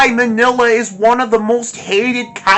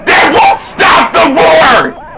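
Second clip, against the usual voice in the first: shouting in fury, higher and louder; real angry rant; 6 semitones higher, 6 dB louder than usual. Real, not acted. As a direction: explode at how bad the movie is. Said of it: "I'm just too angry talking about this movie".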